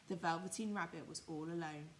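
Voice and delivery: ordinary delivery, nothing marked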